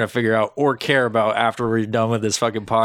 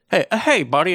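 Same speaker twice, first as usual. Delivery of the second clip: high, light mock-friendly call; a lightly voiced timid visitor; friendly greeting; 8 semitones higher than usual. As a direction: play the polite visitor saying hi. Characters greeting the booth guy.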